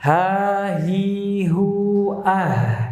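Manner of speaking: long, held HA, HI, HU, ending in an open AH; sound flowing out. Shown as a demonstration. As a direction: let AH run out in a full breathy H. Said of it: "Umpamanya contoh seperti ini".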